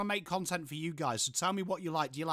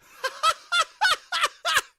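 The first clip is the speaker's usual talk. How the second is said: sudden very high, loud burst of laughter; real falsetto cackle; high squealing laugh; 10 dB louder than usual. Real, not acted. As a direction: crack up in a shriek. Laughs at burp joke.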